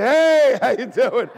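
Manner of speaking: loud, high cheery greeting; friendly hello. Retold or imitated. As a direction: call out a bright hello with a laugh. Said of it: "I just went".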